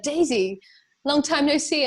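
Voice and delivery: friend's greeting. Retold or imitated. Friend spotting her after years, upbeat greeting.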